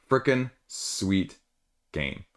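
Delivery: FREAKING SWEET GAME drawn out word by word; slow emphatic repeat. Real, not acted. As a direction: slowly savor each word of "Freaking sweet game", pausing before "game".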